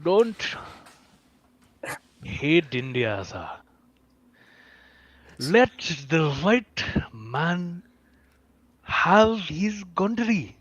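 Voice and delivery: slow mock Indian accent with long pauses; Osho. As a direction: draw it out in an Indian accent, pausing between phrases. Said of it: "You're the Indian accent guy".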